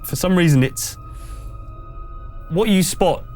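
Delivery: usual speaking voice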